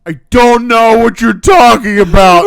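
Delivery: high, loud, drawn-out wail; hammed-up tearful kid protest; mock crying. Crying kid answering the principal, loud high wail. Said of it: "like, crying".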